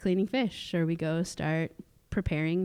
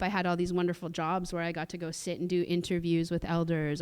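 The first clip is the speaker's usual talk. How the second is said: ordinary talk, nothing marked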